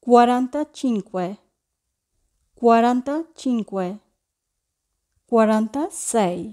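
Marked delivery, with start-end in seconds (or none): none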